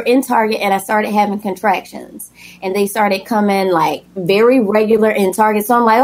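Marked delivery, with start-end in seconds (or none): none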